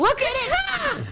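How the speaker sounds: high, slow little bird voice; quail. Pretend. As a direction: squeak it out slowly like a tiny bird. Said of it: "Have a little bird voice".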